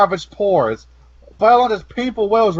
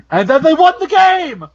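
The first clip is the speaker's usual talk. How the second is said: excited; 8 semitones higher than usual. Acted. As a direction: burst out like a kid winning. Joking mock game ending.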